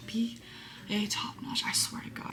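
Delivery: soft whispered mockery; whispered aside. Real, not acted. Aside to viewers, a whispered mocking repeat. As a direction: mutter it back in disbelief.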